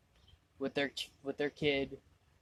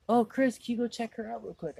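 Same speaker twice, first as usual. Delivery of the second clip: higher, louder attack on the name; raised-pitch mimicked request; lightly voiced coworker request; 7 semitones higher, 4 dB louder than usual. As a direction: call over a coworker for a favour, lightly imitated. Retold coworker asking a favour.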